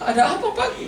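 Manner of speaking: high, alarmed double-take; startled question. Retold or imitated. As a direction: gasp out the question in mock shock. Pastor startled by the shout. (pendeta kaget mendengar teriakan itu, nada tinggi)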